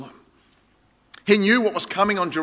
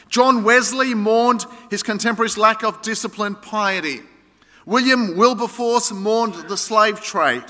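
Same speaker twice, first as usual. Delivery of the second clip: rising preaching; 6 semitones higher, 3 dB louder than usual. Acted. Roll call of reformers.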